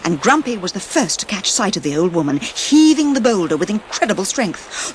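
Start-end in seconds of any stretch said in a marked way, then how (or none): none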